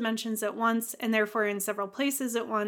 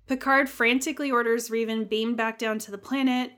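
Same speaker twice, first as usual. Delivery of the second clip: ordinary talk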